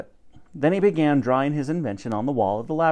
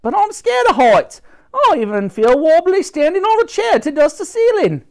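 much higher, louder panicky cleaning lady; scared cleaning lady; 8 dB louder than usual. Acted. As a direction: squeal out her fear of heights. Dottie afraid of heights.

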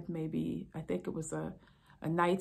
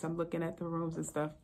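ordinary delivery

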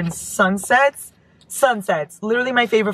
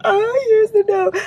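much higher, slow excited squeal; 4 dB louder than usual. Real, not acted. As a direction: squeal it out with delight. Finds a note in a package.

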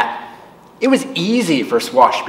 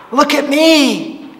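put-on slow, much higher child's call; little girl calling, much higher and slow; 14 semitones higher, slower than usual. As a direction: call out delighted and high. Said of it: "the girl called".

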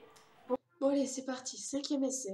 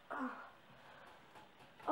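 ordinary delivery

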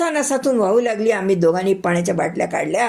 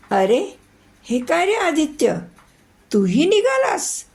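surprised sister; 10 semitones higher than usual. Acted. Sister surprised at brother leaving.

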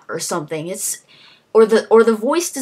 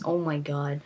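ordinary delivery

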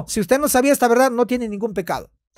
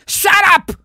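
mock angry shout; 7 semitones higher than usual. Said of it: "¿Sabes qué dijo ahí?".

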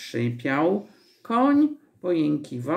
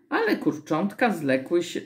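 ordinary delivery

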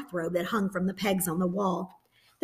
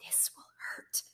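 firm whisper; whispered command; 19 dB quieter than usual. A narrator's reading. Anne-Marie ordering Ellen in a firm whisper. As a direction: order it under the breath. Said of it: "Anne-Marie commanded".

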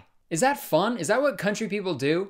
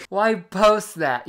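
recovering from laughter; 5 semitones higher, 4 dB louder, much slower than usual. Real, not acted. Still recovering from laughing fit.

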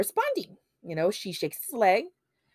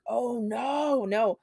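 drawn-out, raised theatrical protest; mock dramatic; 4 semitones higher, much slower than usual. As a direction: retell it as an over-dramatic plea. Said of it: "Dramatic A F, dramatic A F".

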